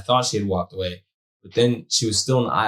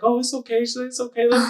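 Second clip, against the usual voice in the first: much higher, sugary imitation of the lady; mock soothing lady; 13 semitones higher, faster than usual. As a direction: play the short lady reassuring you. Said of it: "she was like".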